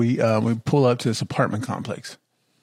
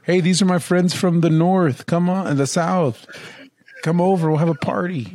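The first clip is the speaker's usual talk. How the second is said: higher-pitched mock welcome; party host invitation; 6 semitones higher than usual. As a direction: call friends over to a party, pitched up. Voices a party host's invitation.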